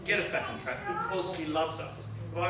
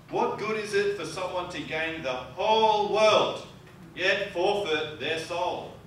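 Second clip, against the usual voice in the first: scripture reading; 4 semitones higher than usual. Acted. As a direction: read the verses with solemn weight. Reads scripture aloud.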